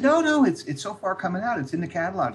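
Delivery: high, loud protest; retold eager insistence. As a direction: insist excitedly, pitched well up.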